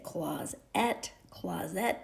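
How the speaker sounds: "closet" with a full ET, rhyming with set; wrong trial pronunciation. Shown as a demonstration. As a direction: say "closet" as CLOZ-ET, second syllable fully voiced. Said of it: "is how we say it. We don't".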